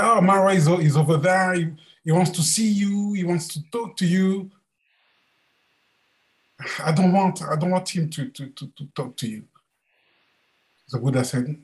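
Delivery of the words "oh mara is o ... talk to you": higher, worried servant's report; a nervous disciple blurting out the news; agitated servant voice. Acted. Ananda warns the Buddha, higher and anxious.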